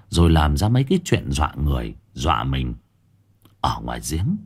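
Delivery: ordinary delivery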